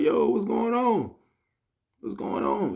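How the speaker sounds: high, drawn-out holler; calling out. Retold or imitated. Inmates yell out to ask what's going on, calling out. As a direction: call out loud, stretching the words.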